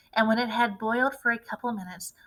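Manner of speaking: ordinary delivery, nothing marked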